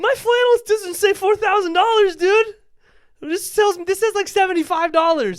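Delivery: very high, loud comic rant; outraged squeal. Retold or imitated. Comic protesting a four-thousand-dollar camera, outraged squeal. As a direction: shriek it in mock outrage at the price. Mock outburst at camera's price.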